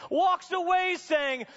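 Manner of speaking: preaching hard and high, driving the story on; full-force preaching. Acted. Preacher to Bible college students, preaching at full force. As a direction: preach it like the whole room must hear.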